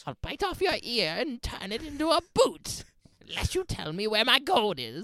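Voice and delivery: high leprechaun voice, menacing; squeaky threatening little leprechaun. Acted. Quoting the leprechaun.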